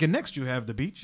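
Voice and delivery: low, soft, unruffled guide patter; unfazed professional. Retold or imitated. Guide didn't address what happened, moves on, unfazed professional. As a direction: imitate someone carrying on as if nothing happened. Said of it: "he didn't address what happened".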